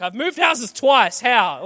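exasperated. Retold or imitated. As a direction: complain like the sand follows you forever. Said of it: "exasperated about sand everywhere".

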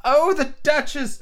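high, slow fussy rabbit cry; fretting rabbit. A narrator's reading. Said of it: "muttering to himself".

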